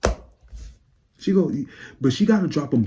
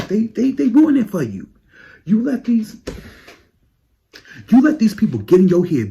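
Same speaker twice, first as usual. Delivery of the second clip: much higher, louder scolding; 11 semitones higher, 7 dB louder than usual. Acted. Fan lecturing [name] on losing album of the year, loud high scold. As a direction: scold her like a friend who blew it.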